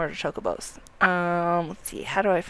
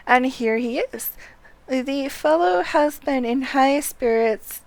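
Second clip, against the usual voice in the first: louder, higher, formal announcing delivery; game dialogue read aloud; 3 semitones higher, 7 dB louder, slower than usual. Acted. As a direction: read it out like an official handing over a steed. Reads game character's dialogue aloud.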